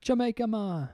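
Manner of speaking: high, put-on Jamaican accent; mock Jamaican accent. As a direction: try a hammed-up Jamaican accent. Puts on Jamaican accent after failed attempt.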